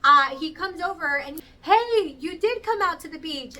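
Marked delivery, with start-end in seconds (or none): 1.6-3.5 high, loud cheery hello; excited greeting. Retold or imitated. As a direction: greet her like a pleasant surprise. DJ greeting her at beach.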